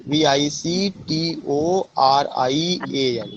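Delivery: "वी आई सी टी ओ आर आई ए एन" read slowly as separate letters; slow letter-by-letter spelling for the class. Shown as a demonstration. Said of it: "Victorian age में".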